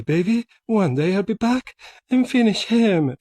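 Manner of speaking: ordinary delivery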